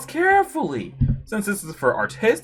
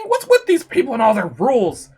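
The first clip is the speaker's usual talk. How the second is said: normal talking voice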